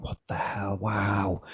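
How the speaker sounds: a soft stunned gasp of a wow; hushed awe. Retold or imitated. First breathwork rounds, stunned and hushed. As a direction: breathe it out in quiet amazement. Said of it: "you're like".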